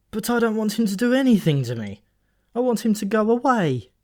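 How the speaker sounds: lightly voiced nervous young man; protesting young man. A narrator's reading. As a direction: plead that he just wants Wotan gone. Malcolm voiced by reader.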